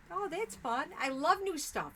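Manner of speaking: high, bright burst of delight; light up at the idea. Real, not acted. Crafter thrilled by chat's new order, high delighted.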